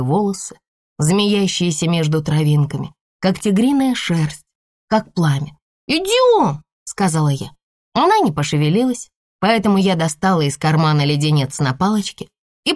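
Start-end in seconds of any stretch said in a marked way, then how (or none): 5.9-6.6 put-on high urging little girl; bossy child. A narrator's reading. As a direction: hurry the little one. (нетерпеливый детский голос)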